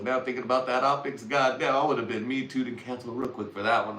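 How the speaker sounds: normal talking voice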